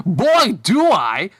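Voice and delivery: eager, delighted cry; happy exclamation. A narrator's reading. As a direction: exclaim it happily, punching it out. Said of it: "Bob happily exclaimed".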